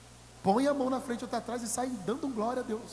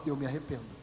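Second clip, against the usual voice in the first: soft, low humble confession; quiet repentance; 8 semitones lower, 7 dB quieter, faster than usual. Retold or imitated. Repentant believer confessing to God, soft and low. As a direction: say it low, like a contrite sinner.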